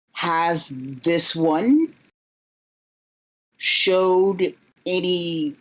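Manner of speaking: very slow, drawn-out official questions; slow formal questioning. Acted. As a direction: ask each word like a ritual interrogation. Interrogator continues questioning.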